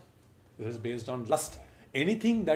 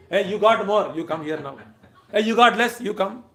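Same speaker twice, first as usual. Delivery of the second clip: loud, higher playful summons; calling out; 5 semitones higher, 9 dB louder than usual. Acted. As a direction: call the monkeys over for their share of curd. Krishna calling monkeys to share curd.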